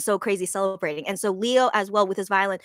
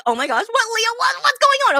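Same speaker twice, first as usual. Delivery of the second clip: shocked; 12 semitones higher, 5 dB louder than usual. Retold or imitated. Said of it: "I'm just like".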